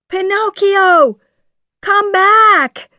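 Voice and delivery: high, loud call after someone; shouting. A narrator's reading. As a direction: shout urgently for him to return. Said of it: "shouts Jiminy".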